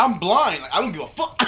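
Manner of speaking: high, loud mock outrage; playfully outraged retort; indignant comeback. Acted indignant comeback to Reed.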